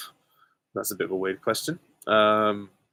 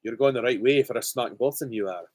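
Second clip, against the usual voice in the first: Shrek. Acted. Vlogger quoting a film ogre for fun, in a Scottish accent. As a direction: put on a Scottish ogre's accent. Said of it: "like Shrek, the, the Scottish accent".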